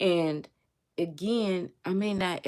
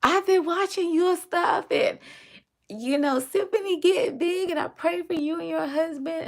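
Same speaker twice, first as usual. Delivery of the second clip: imitating the caller in a much higher, louder voice; gushing caller; 12 semitones higher, 5 dB louder than usual. Caller telling the vlogger she prays for her, excitedly. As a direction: replay her excited praise, pitched way up. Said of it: "And she was like".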